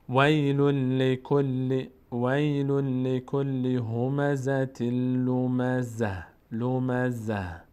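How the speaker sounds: slow, level chant stopping on a final h; Quran recitation. Shown as a demonstration. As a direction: recite in measured tajweed with held doubled consonants. Recites the verse. (تلاوت شمرده و کشیده با وقف روی ها)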